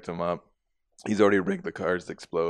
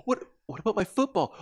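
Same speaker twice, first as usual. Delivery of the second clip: imitating whiny kid; 12 semitones higher than usual. Kid asking about football.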